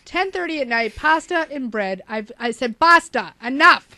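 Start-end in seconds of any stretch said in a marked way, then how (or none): 2.8-3.9 firm refusal. Retold or imitated. Retold refusing late pasta.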